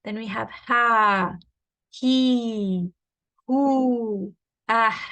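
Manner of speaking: teacher sounding ha, he, hu for students; ha vowel drill. Shown as a demonstration. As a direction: demo ha, he, hu with an open throaty h. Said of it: "So here we have the letter ha".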